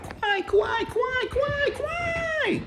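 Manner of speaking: put-on very high-pitched girlish squeal; squealing kawaii. Imitating young Japanese girls gushing over cute things. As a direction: squeal it like an excited young girl.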